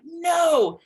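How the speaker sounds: loud, high, drawn-out no; exaggerated protesting "no"; resistant refusal. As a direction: dig your heels in, wail the no. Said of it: "with all my resistance".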